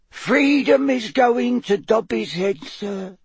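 slow, high, sorrowful house elf; sad elf squeak. A narrator's reading. House elf laments her friend's freedom, squeaking sadly. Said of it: "said Winky sadly".

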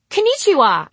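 high, loud cheerful hello; cheery greeting. Acted. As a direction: call it out to new friends. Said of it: "I cried".

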